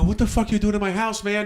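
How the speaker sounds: acted-out exasperated demand; indignant challenge. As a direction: confront an intruder, indignant but not shouting. Retold confrontation with intruding kid.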